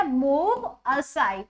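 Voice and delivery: very slow, stretched phrase; slow demo. Shown as a demonstration. Teacher modelling the phrase for the class, very slow. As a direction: drag it out for learners.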